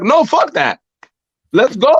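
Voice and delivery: imitated fans rallying in a high voice; defiant crowd. Whole crowd refusing to stop clapping, defiant high chant imitated. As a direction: pitch it up like a crowd egging someone on. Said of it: "everybody's gonna be like".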